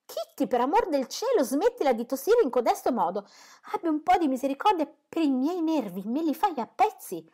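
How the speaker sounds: a mother's shrill-edged scolding about the cough. A narrator's reading. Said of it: "cominciò a sgridare una delle figliole".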